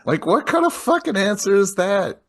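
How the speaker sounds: high-pitched, incredulous outburst; indignant disbelief. Real, not acted. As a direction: rant in exasperated disbelief. Host indignant at Peter's reply.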